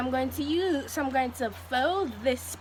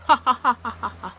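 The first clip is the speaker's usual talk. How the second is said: loud, quick run of ha-ha-ha; hammed-up rapid cackle; staged laugh; 9 dB louder, much faster than usual. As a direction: belt out an exaggerated laugh. Staged laugh amid accent play.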